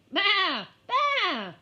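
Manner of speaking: high, loud bleating lamb. Acted. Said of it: "went the lamb".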